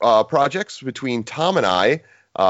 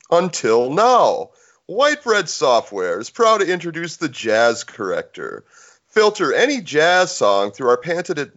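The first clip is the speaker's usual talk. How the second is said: announcer ad voice; ad read; 4 semitones higher than usual. Acted. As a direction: read it like a radio commercial. Reads a mock ad.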